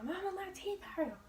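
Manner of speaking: put-on high whining little-girl plea; whiny complaint. Daughter whining to her mom about sore teeth, high plaintive. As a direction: whimper it up to mama.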